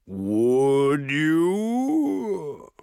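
breathy, threatening ogre voice; menacing ogre hiss. A narrator's reading. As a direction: let the doubt drip with threat. Ogre questions the dwarf.

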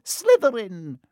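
loud, high cry of the house name; hat screaming. A narrator's reading. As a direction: fling the name out at once. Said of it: "it screamed".

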